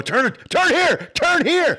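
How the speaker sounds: high, slow, urgent shouts of turn here; frantic yelled directions. Retold or imitated. Teen hanging out the car window, yelling at buddy to turn. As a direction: yell at the driver to turn right now.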